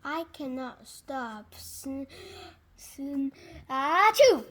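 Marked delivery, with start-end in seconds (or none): 3.7-4.4 big explosive achoo; loud sneeze. Acted. Gerald sneezing on his friend Piggy, loud achoo. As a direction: burst out in a huge sneeze.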